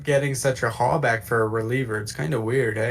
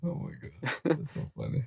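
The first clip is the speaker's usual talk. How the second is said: breathless laughing aside; 3 semitones higher, 11 dB quieter than usual. Real, not acted. Laughing after the quote.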